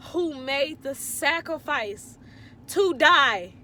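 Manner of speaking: loud, high, building fervent testimony; preaching. Real, not acted. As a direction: preach it with full conviction. Testifying with rising fervour.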